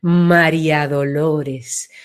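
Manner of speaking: "María Dolores" grave, lento y amplio; ensanchado. Shown as a demonstration. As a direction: deja que "María Dolores" se abra despacio y grave. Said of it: "se ensancha". ("María Dolores" dicho hondo y lento, ensanchándose)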